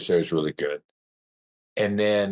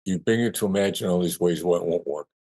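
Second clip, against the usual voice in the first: ordinary talk, nothing marked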